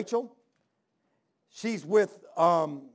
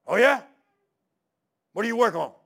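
loud interested reply; 3 semitones higher, 9 dB louder than usual. Retold or imitated. Farmer warms to fellow mechanic.

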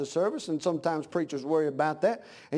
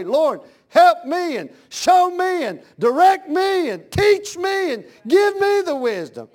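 loud, high, drawn-out plea; fervent preacher's plea, pitched high; pleading prayer; 10 semitones higher, 9 dB louder, slower than usual. Acted. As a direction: cry out slowly to the Lord, leaning on each verb. Acted fervent prayer to God.

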